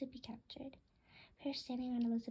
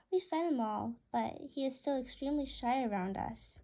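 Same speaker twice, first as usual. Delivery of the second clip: usual speaking voice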